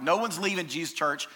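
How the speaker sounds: normal talking voice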